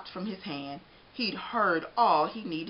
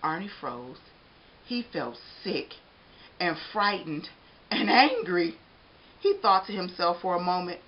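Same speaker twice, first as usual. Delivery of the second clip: usual speaking voice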